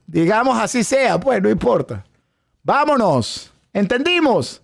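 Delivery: usual speaking voice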